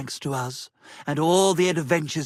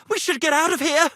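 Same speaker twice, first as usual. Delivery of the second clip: panicked urge; 8 semitones higher, 4 dB louder, much faster than usual. Acted. Sudden panic to flee.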